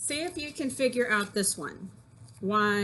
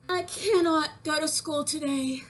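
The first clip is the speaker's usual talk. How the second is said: put-on higher, complaining little-girl voice; whiny sick girl; 5 semitones higher than usual. Voicing sick girl Peggy Ann.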